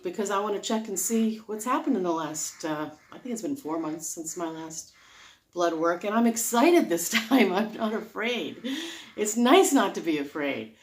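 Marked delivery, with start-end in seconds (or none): none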